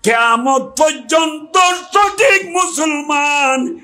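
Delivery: slow, high climactic cry; preacher's rising, drawn-out proclamation; impassioned declaration. Acted. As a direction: build upward and stretch out each word. Climax of Imam's appeal.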